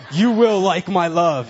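hammed-up demand that the cat be loved; mock menacing insistence. Forcing love on the cat.

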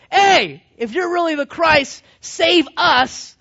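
loud, high jeering shout; mocking taunt. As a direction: heckle him at full voice. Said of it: "Now he's making fun of Jesus".

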